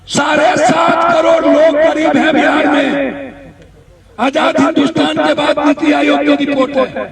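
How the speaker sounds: loud public address to the crowd; rally speech. Real, not acted. Politician addressing protesters about poverty in Bihar, loud and forceful. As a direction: project every line to the back of the rally.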